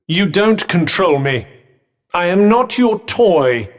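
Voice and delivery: loud, emphatic refusal; proud outburst, each phrase hammered out; indignant defiance. Acted. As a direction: firmly assert your independence at full voice. Refuses to be commanded.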